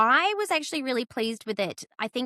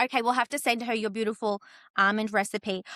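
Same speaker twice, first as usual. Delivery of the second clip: usual speaking voice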